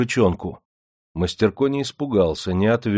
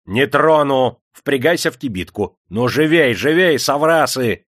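raised, urging command; loud, impatient chivvying; hurrying them on; 5 semitones higher, 5 dB louder than usual. A narrator's reading. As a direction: drive them along like horses. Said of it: "заторопил их хозяин".

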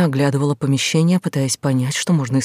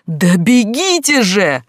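истошный крик, высоко и медленно; сорвись на истерику, очень громко; истерический крик; 7 semitones higher, 7 dB louder, much slower than usual. Acted. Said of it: "истерически заорала Глаша". (истерический вопль)

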